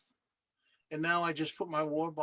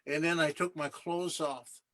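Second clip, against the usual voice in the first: normal talking voice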